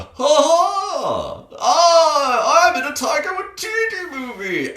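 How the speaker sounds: very high, loud, shouted mock revelry; hammed-up manic god. Imitating Bale's god-killer, high, shouted and hammed-up. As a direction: scream it like a manic cartoon villain. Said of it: "he's doing the".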